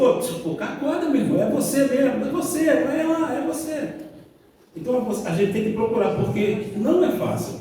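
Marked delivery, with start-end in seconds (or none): 0.0-3.9 higher-pitched, insistent wake-up call; lightly acted God rousing someone, pitched up; God's wake-up call. God scolds an oblivious believer to wake up, insistent and high. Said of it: "Deus tá te falando assim".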